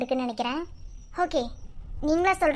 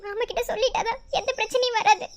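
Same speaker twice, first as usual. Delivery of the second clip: high, sobbing worry; crying; 10 semitones higher than usual. Acted. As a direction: plead through steady tears. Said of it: "நீ எதுக்கு சம்பந்தமே இல்லாம அழுதுட்டு இருக்க". (அழுதபடியே கவலையோடு)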